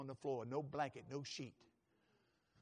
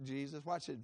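ordinary talk

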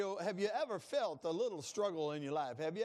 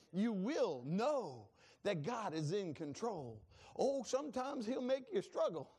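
usual speaking voice

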